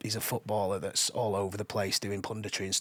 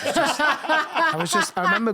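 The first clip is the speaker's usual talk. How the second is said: laughing; 9 dB louder than usual. Real, not acted. Laughing at Ginola bed memory.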